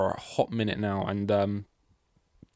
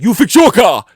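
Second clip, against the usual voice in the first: much higher and far louder, a furious yell; angry shout; 12 semitones higher, 19 dB louder than usual. Retold or imitated. Toto snapping back at Horner in the meeting, furious shout. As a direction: yell it back at him in a rage. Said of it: "And he's like".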